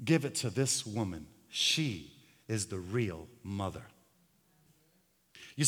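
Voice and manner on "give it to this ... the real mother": king's verdict. Acted. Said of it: "The king said".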